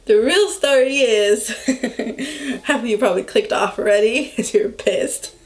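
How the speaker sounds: talking through giggles; real laughing. Real, not acted. Admitting the fake story to viewers, laughing. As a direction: keep breaking into laughter as you explain.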